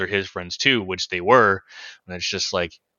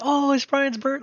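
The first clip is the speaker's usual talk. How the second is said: excited; slower than usual. Retold or imitated. Kid recalling birthday excitement.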